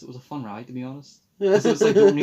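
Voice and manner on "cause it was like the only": laughing. Real, not acted. Laughs recalling fun ride.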